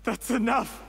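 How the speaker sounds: accused official shouting down his accuser; angry outburst. Acted. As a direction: snap it out to cut her off. Cornered man snapping at accuser.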